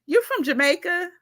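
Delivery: high, mock curious question; curious American asking. Americans hearing her accent, mock curious high question. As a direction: imitate a nosy stranger's pitch jump. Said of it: "they always say".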